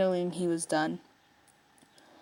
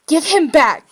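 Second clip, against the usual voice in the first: loud, high furious demand; angry yell; 8 semitones higher, 10 dB louder, slower than usual. A narrator's reading. Keith demanding Lotor return Lance, angry yell. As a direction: shout it at the captor. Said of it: "Keith yelled".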